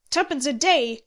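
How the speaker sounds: loud, high outcry; indignant exclamation. A narrator's reading. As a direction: cry it out in outrage. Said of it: "exclaimed Handy".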